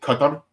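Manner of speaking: Qatar said "CUT-un", stress up front; Qatar as heard. Shown as a demonstration. Guest showing how Arabs say it, Qatar stressed first. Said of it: "I've heard Arabs pronounce it".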